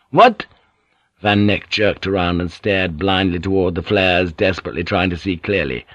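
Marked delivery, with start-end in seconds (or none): 0.1-0.5 high, loud yelp of a question; jolted, blurt it out; startled question. A narrator's reading. Drunk jerking round at a voice, startled question.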